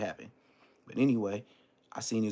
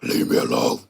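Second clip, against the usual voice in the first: much lower, louder demonic man's voice, slowing to a rasp; deep possessed growl, the last words breathed; demon voice like a grown man; 7 semitones lower, 5 dB louder than usual. Retold or imitated. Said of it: "started talking like a grown man".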